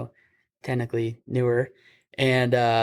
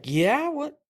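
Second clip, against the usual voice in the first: nervous high; 14 semitones higher than usual. Retold or imitated. Nervous reply to officer.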